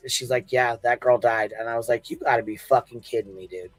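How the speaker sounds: ordinary delivery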